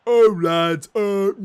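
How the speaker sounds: lightly voiced players pleading at the referee; mock player protest. Footballers crowding the referee, mock protest. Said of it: "they all get around the referee and go".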